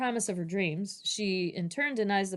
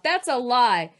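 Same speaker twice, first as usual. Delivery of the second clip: acted child's protest, loud at first, higher after; indignant blurting; 3 semitones higher, 6 dB louder than usual. Child objecting to the teacher about a storybook, blurting indignantly. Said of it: "She blurts out".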